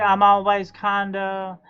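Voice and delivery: ordinary talk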